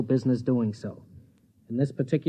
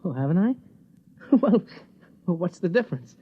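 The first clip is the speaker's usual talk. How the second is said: higher, flustered, laughing it off; 4 semitones higher than usual. Acted. Husband dodging his wife's jealousy, flustered and laughing. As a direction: brush it aside with a nervous laugh.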